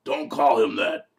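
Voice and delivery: slow, put-on dark lord groan; groan, then slowly scold the underling; hammed-up exasperated ruler of hell; Satan. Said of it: "And he goes".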